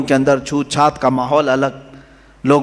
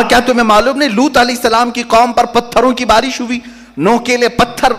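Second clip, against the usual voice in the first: higher-pitched preacher's rebuke; insistent challenge; 8 semitones higher than usual. Retold or imitated. Prophet reminds his people of past punishment, insistent challenge. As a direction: press the warning home in a raised pitch.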